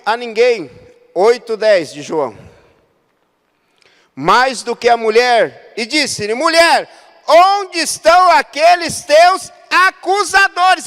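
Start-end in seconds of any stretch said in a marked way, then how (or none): 6.3-10.9 high, loud, slow preaching cry; preaching Jesus's words. Acted. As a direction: call it out to the whole church. Preacher voicing Jesus's question. (pregação em voz alta, lenta e aguda)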